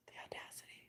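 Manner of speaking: barely audible, voiceless whisper. Real, not acted. Stunned by her audacity, faint whisper. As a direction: whisper it under your breath.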